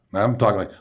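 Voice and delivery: ordinary talk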